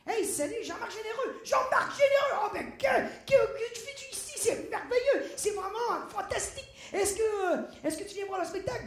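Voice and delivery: high, loud, over-excited greeting; gushing journalist. Retold or imitated. Journalist running into the dancer backstage, gushing. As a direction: greet him with hammed-up delight.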